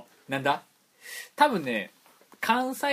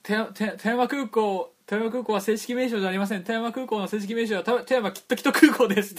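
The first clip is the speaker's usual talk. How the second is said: quoted character line; 7 semitones higher than usual. Retold or imitated. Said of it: "って言って".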